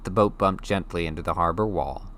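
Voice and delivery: usual speaking voice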